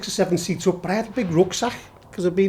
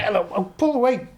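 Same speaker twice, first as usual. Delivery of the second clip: normal talking voice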